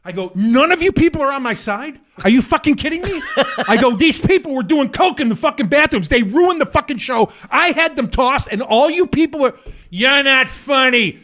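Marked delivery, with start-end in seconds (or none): 0.3-3.2 high, loud indignant appeal; retold outburst at a turned audience; outraged disbelief. 3.9-9.5 high, loud, relentless tirade; retold self-righteous outburst; indignant rant. Ranting at the crowd. 9.9-11.2 high, slow drawn-out yell; imitated crowd heckle, loud and stretched; heckling shout.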